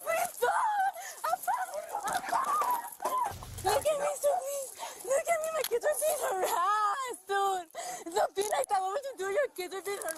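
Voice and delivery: real panicked cry for mercy; distraught pleading. Real, not acted. Suspect begging officers over her kids, distraught.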